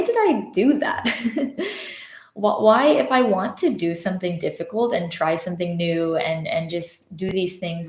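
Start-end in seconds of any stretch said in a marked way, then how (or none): 0.0-2.9 a real laugh through the question. Real, not acted. Telling viewers she laughed at her own overplanning. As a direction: laugh at yourself as you ask it.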